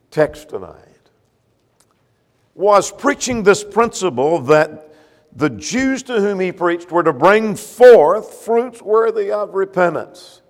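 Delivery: ordinary delivery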